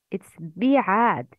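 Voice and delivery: بعاد with a full clear i vowel after the ب; كسرة on بعاد. Shown as a demonstration. Teacher showing students the full kasra, clearly. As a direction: stretch the kasra in بعاد fully. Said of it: "Don't forget to complete the كسرة".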